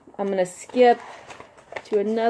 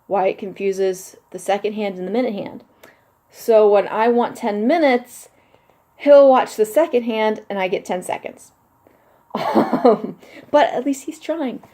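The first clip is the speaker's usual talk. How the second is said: plain reading voice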